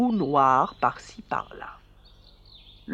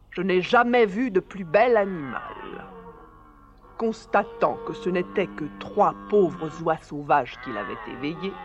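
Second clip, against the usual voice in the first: ordinary delivery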